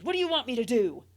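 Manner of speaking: loud, fast exasperated shout; exasperated yell. Acted. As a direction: throw up your hands and yell. Exasperated mother yelling.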